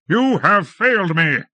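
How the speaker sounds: high, loud scolding; furious accusation. A narrator's reading. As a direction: blame him to his face. Lord berating his servant.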